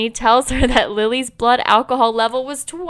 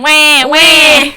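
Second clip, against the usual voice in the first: high, loud fake baby wail; imitating a baby's cry; mock baby crying; 5 semitones higher, 8 dB louder than usual. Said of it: "He's crying".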